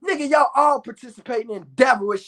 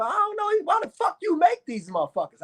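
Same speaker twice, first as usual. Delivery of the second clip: higher, defensive comeback; cussing back at God; 6 semitones higher than usual. Acted. Man arguing back at God about his creations, defensive. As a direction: throw the question back in a raised pitch. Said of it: "I be cussing at him".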